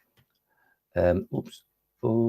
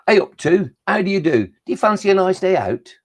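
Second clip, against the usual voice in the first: higher-pitched, friendly invitation; cheery greeting in character; 8 semitones higher than usual. Acted. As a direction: hail a pal for a day out. Number calls to another in poem.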